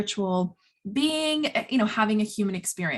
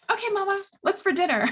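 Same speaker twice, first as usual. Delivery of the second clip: put-on much higher, sweet little-kid voice; cheery child voice; 10 semitones higher, faster than usual. A toddler turning to mama after the tantrum, chirpy and high. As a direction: switch to a bright toddler asking for dinner.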